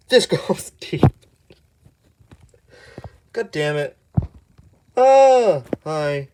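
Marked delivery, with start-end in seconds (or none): 5.0-5.6 sudden loud high yelp; loud surprised ah; startled yelp. Real, not acted. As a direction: jump in fright. Startled by something in game.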